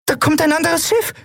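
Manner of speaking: very high, excited voice; excited report. Acted. Nelen reporting an approaching ship, high excited call. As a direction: announce the sighting in high excitement.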